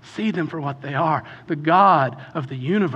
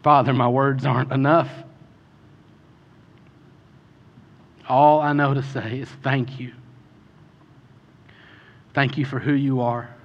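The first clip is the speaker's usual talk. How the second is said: closing prayer; 4 semitones lower than usual. Real, not acted. Closing prayer over music.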